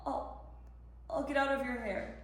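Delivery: soft, deflated mumble of apology; meek retreat. Acted. Mother leaving someone alone after being rebuffed, deflated. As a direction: back off quietly, giving up.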